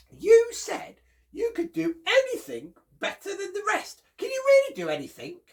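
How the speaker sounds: very high, loud squeaky challenge; chipmunk voice. Acted. Chipmunk challenging Bear, very high and loud. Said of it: "And Chipmunk said".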